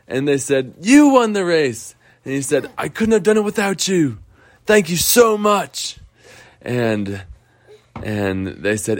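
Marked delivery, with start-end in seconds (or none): 0.8-6.0 higher, gently grateful voices; happy characters. Acted. Friends congratulating the winning shark, higher and glad. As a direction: say it with a glad thank-you. Sharks and Lars exchanging thanks.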